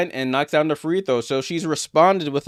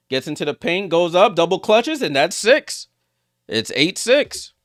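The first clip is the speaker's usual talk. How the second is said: excited play-by-play; 4 semitones higher, 4 dB louder than usual. Real, not acted. Commentator calls a drive and tying run, excited. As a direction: pitch lifting as the shot goes up.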